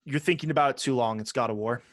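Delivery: ordinary delivery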